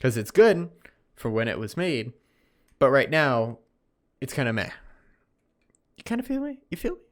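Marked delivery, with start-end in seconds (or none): 6.0-7.0 squeaky high, soft teasing; playful high voice. Pretend. Host teasing his podcast listeners in a squeaky high voice. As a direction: pitch it way up and coax them.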